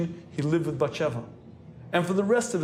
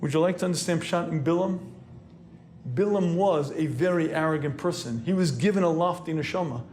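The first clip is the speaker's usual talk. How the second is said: usual speaking voice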